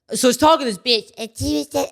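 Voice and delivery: hammed-up stand-up female impression; male comedian's female voice. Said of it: "and they do this voice".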